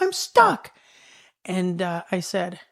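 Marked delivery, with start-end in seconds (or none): 0.0-0.7 high-pitched mock holler; imitated calling out. Mom stuck in manure answers child, imitated high holler. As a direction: repeat it high, calling from afar. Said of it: "And she's like".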